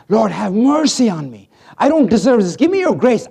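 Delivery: loud, high pleading cry; leper crying out. Acted. Leper calling out to Jesus, loud pleading. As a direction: beg at the top of the voice. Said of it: "calling out to Jesus".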